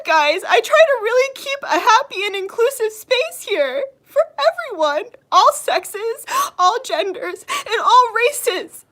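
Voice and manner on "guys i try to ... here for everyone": normal talking voice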